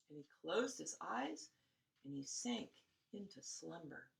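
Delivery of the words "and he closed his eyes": ordinary delivery, nothing marked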